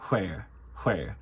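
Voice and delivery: Irish "where" starting with a breathy H. Shown as a demonstration. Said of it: "put an H before the".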